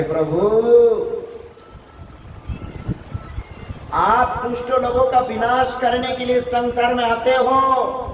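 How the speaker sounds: high-pitched declaimed plea; pleading wives. Acted. Serpent's wives appeal with folded hands, pleading high. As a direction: appeal to the Lord in a high, sustained voice. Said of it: "हाथ जोड़ करके कहने लगे".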